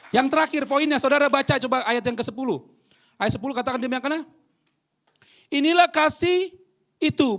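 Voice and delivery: high, loud, fervent preaching; preaching at full force. Acted. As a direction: thunder the verse at them. (khotbah berapi-api, sangat tinggi dan keras)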